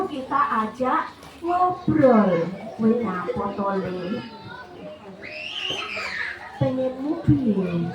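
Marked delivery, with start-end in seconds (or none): 2.8-7.9 put-on low, soft, slow question to a child; even, level tone. Shown as a demonstration. Parent calming an upset child before chatting, in a level tone. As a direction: ask gently in a lowered, unhurried monotone. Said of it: "dengan nada yang datar".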